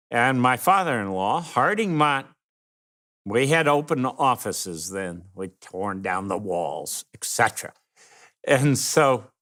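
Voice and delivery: normal talking voice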